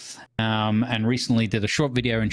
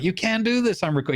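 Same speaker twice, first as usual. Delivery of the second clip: annoyed parent; 11 semitones higher, faster than usual. Retold or imitated. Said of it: "instead of be like".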